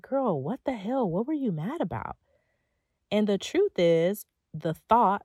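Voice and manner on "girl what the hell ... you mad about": sassy self-scolding. Retold or imitated. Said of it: "I was like".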